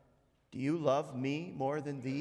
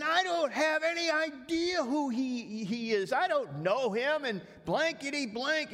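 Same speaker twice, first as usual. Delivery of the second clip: cursing denial; 12 semitones higher, 4 dB louder than usual. Acted. Peter swears at the crowd, high and loud. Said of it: "he started swearing".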